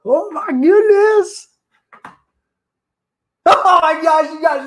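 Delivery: high, loud, slow gasp of joy; real disbelief as it happens; stunned happy cry; overwhelmed. Real, not acted. Reaching the milestone live.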